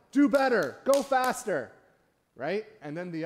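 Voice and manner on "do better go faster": loud, high barking orders from a golf cart; coach yelling. Retold or imitated. Track coach yells at runners from golf cart, loud. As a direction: yell at the runners to push harder.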